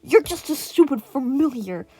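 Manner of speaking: loud, high contemptuous yell; villain yelling. A narrator's reading. Villain yelling down at the lion, loud and high. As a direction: sneer it at full volume. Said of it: "the villain yelled".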